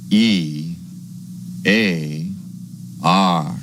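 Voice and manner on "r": ordinary talk, nothing marked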